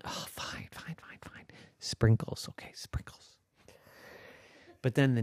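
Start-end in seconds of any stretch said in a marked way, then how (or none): 0.1-3.4 soft, defeated whispered giving in; grudging whisper. Acted. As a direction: mutter it under your breath, giving up. Fish grudgingly gives in.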